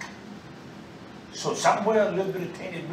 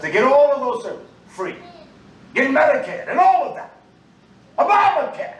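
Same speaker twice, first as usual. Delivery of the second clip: mocking outrage; 9 semitones higher, 5 dB louder than usual. Real, not acted. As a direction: voice climbs high and loud in scorn. Mocking what others get free.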